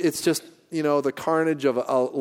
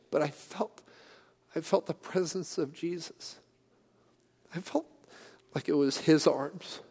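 choked up; 5 semitones higher, 5 dB quieter than usual. Real, not acted. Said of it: "I don't mean to be emotional".

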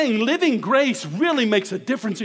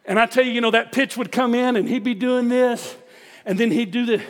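usual speaking voice

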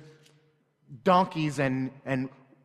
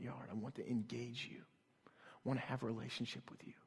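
soft, hushed whisper, much quieter; whispering God; 3 semitones lower, 12 dB quieter than usual. Retold or imitated. God whispering to a father, hushed and tender. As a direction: let it drop to a gentle inner whisper. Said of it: "God whispering to me".

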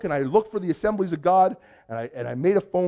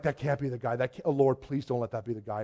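low, hushed plea to himself; imitating a dreading prayer under the breath; muttered plea; 7 semitones lower, 9 dB quieter than usual. Underdressed visitor sees a man in a suit, muttered plea.

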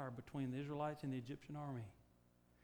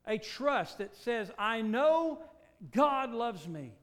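louder and higher, slow; rising preaching affirmation; 6 semitones higher, 12 dB louder, slower than usual. Acted. Preacher closing with confident trust in God. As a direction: build it up with conviction.

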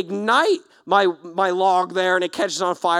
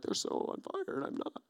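softer, breathy unvoiced self-pity; mopey whisper; 6 semitones lower, 17 dB quieter than usual. Believer envying worship leaders, mopey whisper. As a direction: mutter it under your breath.

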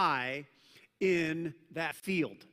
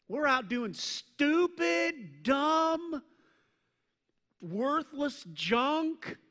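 preaching emphasis; 9 semitones higher, 5 dB louder, much slower than usual. Real, not acted. Preacher building on human folly.

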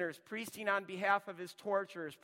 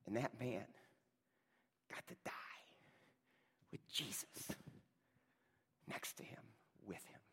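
soft near-whisper, tender; 8 semitones lower, 8 dB quieter than usual. Acted. Preacher hushing the church at the cross, whispered. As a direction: let it fall to a breath. Tender hushed climax of story.

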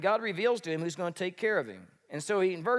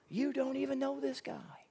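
whispered aside; 7 semitones higher, 6 dB quieter than usual. Acted. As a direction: hush it to the crowd. Aside to congregation.

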